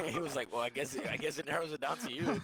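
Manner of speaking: telling it through laughter; amused, laughing. Real, not acted. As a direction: let the laugh spill into the quote. Laughs while retelling the driver's admission.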